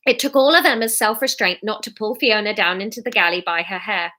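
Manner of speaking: ordinary delivery, nothing marked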